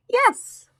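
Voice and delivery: high, bright eager yes; postman's yes. Acted. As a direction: answer cheerfully as the postman. Postman answers yes in story.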